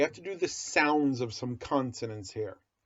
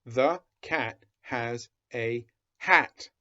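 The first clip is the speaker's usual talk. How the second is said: slow, word by word; slow reading aloud; slower than usual. Shown as a demonstration. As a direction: read each word slowly to a child. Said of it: "go nice and slow".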